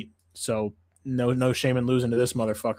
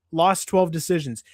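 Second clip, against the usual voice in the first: ordinary delivery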